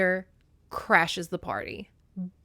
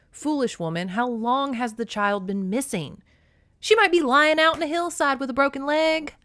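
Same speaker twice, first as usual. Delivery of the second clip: mild rebuke building to louder, higher alarm; lightly voiced scolding Zeus; 7 semitones higher, 5 dB louder than usual. A narrator's reading. Zeus scolds Demeter over the missing child, worried. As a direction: chide her, then raise the alarm.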